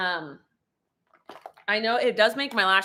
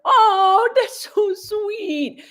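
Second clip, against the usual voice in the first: touched; 12 semitones higher, 5 dB louder, much slower than usual. Real, not acted. Touched by viewer's kind comment.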